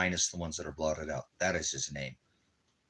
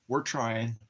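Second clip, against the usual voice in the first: higher, sing-song voice for the dog; pet talk; 6 semitones higher, 3 dB louder than usual. Real, not acted. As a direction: coo it to the puppy. Talking to his dog.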